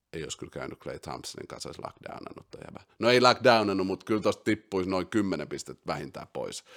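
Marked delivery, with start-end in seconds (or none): 0.1-2.8 fast, much softer, whispered aside; muttered aside. Real, not acted. Host muttering an aside about Klay Thompson, whispered. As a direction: toss it off under the breath.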